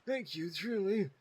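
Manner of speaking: lightly voiced grateful old man; a hint of a creaky old man; annoying old man voice. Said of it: "I was doing an annoying old man voice".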